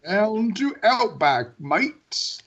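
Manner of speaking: slow, hammed-up Australian ad voice; mock Outback commercial in a put-on Australian accent; fake Australian accent. Mocking the Outback voiceover guy in a fake Australian accent. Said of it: "the fakest Australian accent".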